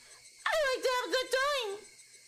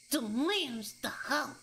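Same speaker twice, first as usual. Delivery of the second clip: mock ominous voice; 11 semitones lower, slower than usual. Shown as a demonstration. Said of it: "mock ominous repeat".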